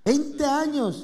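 slow, marvelling repeat of the number; impressed. Real, not acted. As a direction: stretch it slowly in amazement. Host impressed by twenty years.

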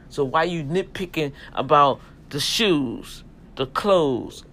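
loud, punchy street preaching; fired-up sermon to the men. Acted. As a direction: preach it hard to the brothers.

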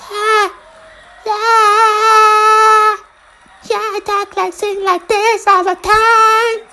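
high wailing falsetto, sung slow; hammed-up Fallon singing voice; Jimmy Fallon impression. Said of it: "This is my Jimmy Fallon impersonation".

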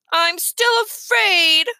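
put-on high, slow whine of a small boy; whining child. A narrator's reading. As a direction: drag it out like a frightened kid. Child Fudge voiced in story.

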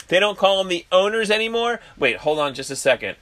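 ordinary talk, nothing marked

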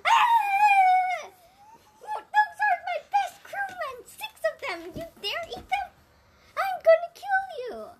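high, loud enraged yelling; furious shout. Acted. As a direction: scream with rage at the monster. Odysseus furious at Scylla.

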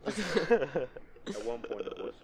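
laughing through it. Real, not acted. Laughing at Gonzalo joke.

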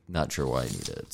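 ordinary talk

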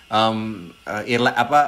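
ordinary talk, nothing marked

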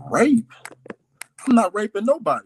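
loud, high retold outburst; indignant denial. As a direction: re-enact a shocked, indignant protest. Retells indignant denial to stepmother.

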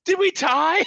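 imitated yell, pitched very high; high-pitched mock shout; Jeff's yell. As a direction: call it out in a high, put-on voice. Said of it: "you just hear Jeff yell".

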